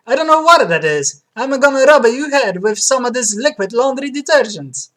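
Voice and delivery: high, loud Italian barber; Italian accent. Acted. Barber welcoming a customer, Italian accent. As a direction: play an exuberant Italian barber. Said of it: "platinum blonde with Italian accent".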